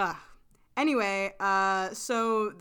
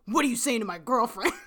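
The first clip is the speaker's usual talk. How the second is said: fast, louder mock confrontation; storming mock anger; 6 dB louder, faster than usual. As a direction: storm in and demand it, playfully. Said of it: "and he storms over".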